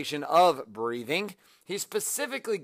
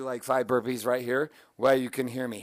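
usual speaking voice

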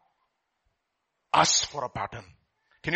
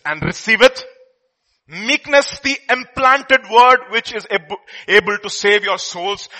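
scripture reading; 4 semitones higher, 7 dB louder than usual. Acted.

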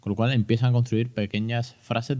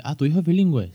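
lightly mocked know-it-all parent, higher; nosy parent; 6 semitones higher than usual. Father mimicking a nosy parent who doubts bilingual kids, higher. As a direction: ask it like a nosy acquaintance, higher. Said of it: "Cada vez que alguien me dice".

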